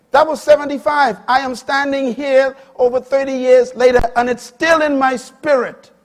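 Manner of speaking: high, very loud pulpit climax; preaching. Acted. Preacher driving the integrity lesson home, full force. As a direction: shout the lesson to the back row.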